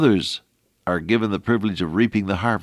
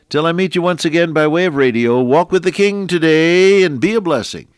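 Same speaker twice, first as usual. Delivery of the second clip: loud, higher, rousing farewell; radio sign-off; 10 semitones higher, 8 dB louder than usual. Acted. Radio preacher bidding listeners farewell, rousing and loud. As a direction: send the listeners off with a lift.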